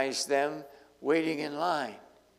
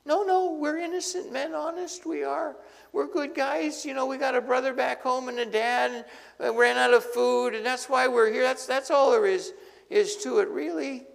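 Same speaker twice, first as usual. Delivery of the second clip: high, anxious pleading voice; pleading brothers; 8 semitones higher than usual. Acted. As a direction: beg him to believe you.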